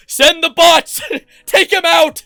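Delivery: hammed-up war cry for bot army; mock battle cry. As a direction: yell it like a general sending troops.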